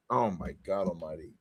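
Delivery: normal talking voice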